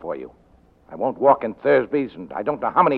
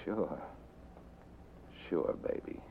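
near-whispered, much softer; hushed reply; 5 semitones lower, 18 dB quieter, slower than usual. Acted. Detective answering her plea while reaching for the phone, whispered. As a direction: breathe it coldly, almost no voice. Cold reply before calling the cops.

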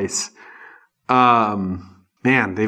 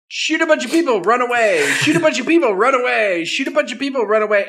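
high, loud mocking chant; mock the plot in a bouncy singsong; mocking sing-song; 10 semitones higher, 5 dB louder, faster than usual. Mocking the movie's repetitive plot.

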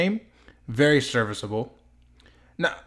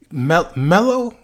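slow, high, louder disbelief on Melo?; stunned Mel-, Melo?; incredulous; 5 semitones higher, 6 dB louder, slower than usual. Real, not acted. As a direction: say Melo? as if the doubt is absurd.

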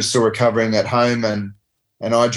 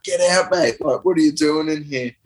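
raised-pitch indignant challenge; high mock yell, easing into a demand; retold arguing; 5 semitones higher than usual. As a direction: act out confronting an intruder. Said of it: "we're arguing".

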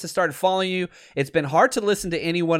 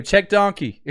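louder, punchy call of "Check donkey"; calling out the play; 4 semitones higher, 4 dB louder than usual. Retold or imitated. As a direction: bark the call like a coach on the sideline.

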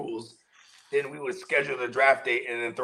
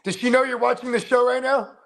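high-pitched mock-serious questioning; put-on concern, high voice; 10 semitones higher, 4 dB louder than usual. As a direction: imitate someone earnestly checking, pitched well up. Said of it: "He's real serious".

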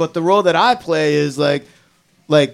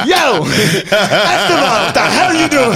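high, loud drill sergeant yell; 5 semitones higher, 4 dB louder, slower than usual. Pretend. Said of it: "And the drill sergeant".

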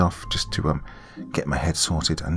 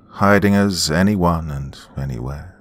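ordinary delivery

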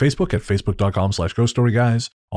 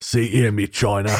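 put-on south London accent. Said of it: "my London accent".

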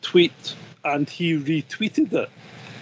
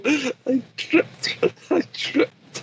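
high, whiny falsetto protest; squeaky pretend excuse; mock innocent excuse; 9 semitones higher, much slower than usual. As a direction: play the caught man pleading he tripped.

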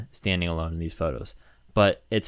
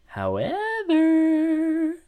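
drawn-out high however; much slower than usual. Acted.

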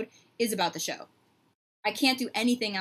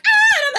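crowd gasp imitation; 14 dB louder than usual. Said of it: "everyone's like".

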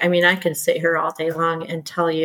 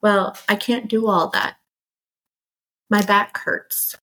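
mock victim voice; 3 semitones higher than usual. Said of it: "the victim".

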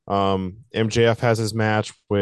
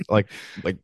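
ordinary delivery

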